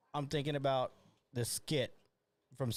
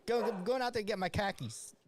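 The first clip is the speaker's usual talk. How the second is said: mock Boston accent; 7 semitones higher than usual. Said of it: "khakis and cockies in a Boston accent".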